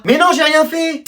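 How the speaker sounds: high, drawn-out denial; defensive protest. Acted. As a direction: protest your innocence, slow and high. Accused of touching the phone. (protestation aiguë et traînante)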